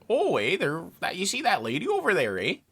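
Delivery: sing-song Canadian man with swooping pitch; hammed-up Canadian lilt; Canadian accent. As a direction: imitate a very Canadian coworker. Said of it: "he's this proper Canadian man".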